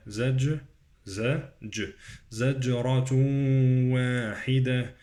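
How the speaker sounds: broken-down "زج-" with bouncing j; qalqalah on jim. Shown as a demonstration. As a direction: echo the j, then merge "زجرة" nasally into "واحدة". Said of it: "قلقلة الجيم وإدغام".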